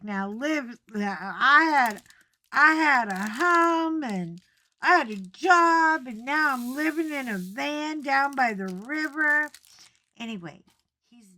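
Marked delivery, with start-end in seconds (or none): 0.0-9.5 motivational speaker shout. Retold or imitated. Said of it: "He was like".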